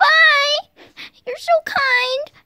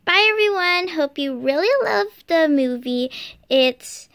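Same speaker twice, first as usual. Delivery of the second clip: story narration ending; 11 semitones lower than usual. A narrator's reading.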